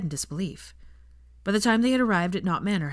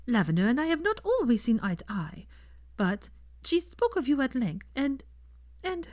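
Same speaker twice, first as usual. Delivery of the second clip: Fleur; 7 semitones higher than usual. A narrator's reading. Fleur asks Draco for time with Hermione, polite and higher.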